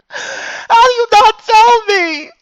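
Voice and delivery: high, slow, loud mock-sobbing wail; crying wail. Real, not acted. Streamer to viewers who never warned him, wailing.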